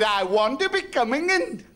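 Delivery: lightly put-on Pakistani accent, a pleading ask; a hint of a Pakistani accent at heaven's gate; mock Pakistani accent. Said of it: "So there was this Pakistani".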